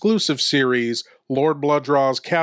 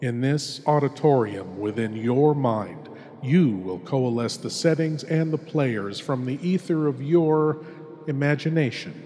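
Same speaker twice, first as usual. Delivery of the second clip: hushed horror host; 4 dB quieter than usual. Acted. Horror host welcoming the lone listener into darkness, hushed and ominous. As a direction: draw the listener into the dark like a sinister host.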